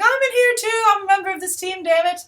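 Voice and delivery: high, loud, aggrieved protest; overlooked teammate pleading to count; indignant Hawkeye. Retold or imitated. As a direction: whine that you're on the team too. Hawkeye protesting being left out.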